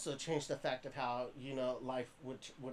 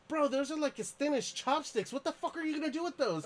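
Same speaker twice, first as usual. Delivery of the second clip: high, disbelieving voice; high mocking disbelief; incredulous friend; 12 semitones higher, 3 dB louder than usual. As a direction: squeak it out in disbelief. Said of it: "he looks at me and he goes".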